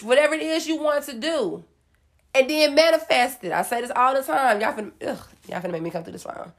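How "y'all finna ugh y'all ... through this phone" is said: exasperated mutter. Real, not acted.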